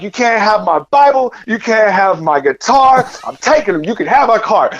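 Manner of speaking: high, frantic pleading yell; panicked shouting at strangers in a lot. Retold or imitated. Panicked man yelling at strangers in a parking lot, high and frantic.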